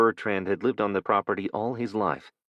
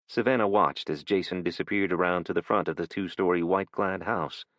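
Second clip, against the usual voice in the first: ordinary delivery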